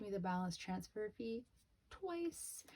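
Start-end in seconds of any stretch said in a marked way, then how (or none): 1.9-2.6 "twice" squeaked very high and soft; incredulous. Real, not acted. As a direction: pitch the word up in disbelief. Outraged punchline about the double fee.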